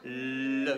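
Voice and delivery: "le" with a long, low L; sounding out. Shown as a demonstration. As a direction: hold the first sound of "le" so it can be spelled. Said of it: "Qu'est-ce que j'entends en premier".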